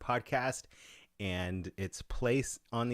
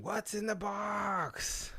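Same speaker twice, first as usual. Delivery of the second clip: high, slow dramatic movie line; movie quote; 13 semitones higher, much slower than usual. Retold or imitated. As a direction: stretch it out like the film's desperate cry. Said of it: "Dari is mentioning".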